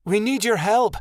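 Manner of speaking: high, urgent call for help; yelled appeal. A narrator's reading. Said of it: "Midnight Star yelled".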